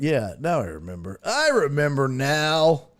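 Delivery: ordinary talk, nothing marked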